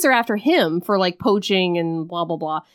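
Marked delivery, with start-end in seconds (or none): none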